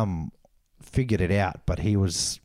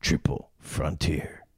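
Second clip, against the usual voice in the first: narrating voice; 5 semitones lower, 3 dB quieter than usual. Pretend. Co-host naming the film to end his summary, narrating voice. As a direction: announce the title with hushed, deliberate weight. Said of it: "my narrating voice".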